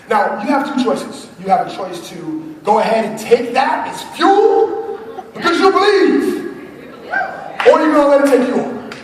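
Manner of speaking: rally the room like a revival preacher; preaching to the crowd. Acted. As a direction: speaker firing up the audience, in full preaching voice. Motivational preaching.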